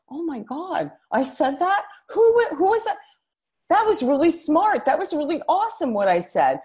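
high, excited squeal of surprise; amazed delight. Retold or imitated. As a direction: gush in disbelief at your own words. Hearing herself on the podcast.

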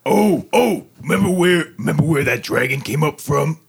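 sudden high excited burst. Real, not acted. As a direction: blurt it out, suddenly thrilled. Sudden idea about the dragon.

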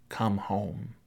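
hushed, tender final call; softly calling a child home; soft plea. Acted. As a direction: say it quietly, like an invitation.

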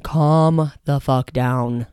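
very slow, drawn-out calm the fuck down; slow emphatic. Shown as a demonstration. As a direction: stretch it out to make the point. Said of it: "take a deep breath and".

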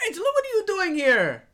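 high, loud astonished outcry; shocked recognition. Acted. Jose spotting Angelo at the ritual, high shocked cry. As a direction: call out in disbelief.

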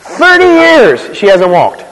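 slow, high, loud outrage; indignant. Acted. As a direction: let them feel the absurdity. Preacher outraged at church response.

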